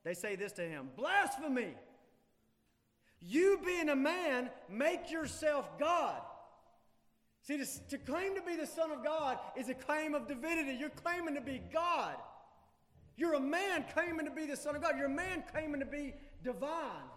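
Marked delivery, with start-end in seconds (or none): 1.0-6.2 higher, much louder, slow "You being a man, make yourself God"; outraged accusers. Acted. Crowd accuses Jesus of blasphemy, outraged shout. 7.4-17.1 forceful preaching. Real, not acted. Preacher presses the point.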